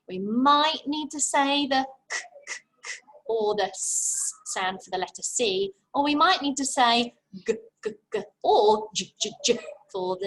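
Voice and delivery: k, k and g, g, j, j clipped and repeated; letter sounds drilled; repeated phonics sounds. Shown as a demonstration. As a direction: pop out each sound twice. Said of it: "Now remember".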